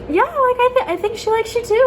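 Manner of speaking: a hint of a giddy friend; high girly voice. Roommate voiced in story.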